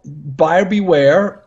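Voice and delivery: Buyer beware, each word drawn out slowly; the show's message, delivered slowly as a warning. Shown as a demonstration. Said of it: "this is the message of the show".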